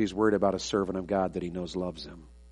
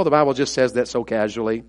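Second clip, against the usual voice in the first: usual speaking voice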